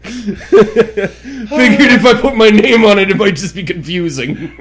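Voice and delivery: high, loud words through laughter; laughing. Real, not acted. Telling a friend about the fridge label, laughing. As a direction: crack up while explaining.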